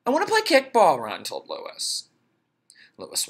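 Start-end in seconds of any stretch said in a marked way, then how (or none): 0.1-1.0 put-on 1 high kid voice. Ron voiced again.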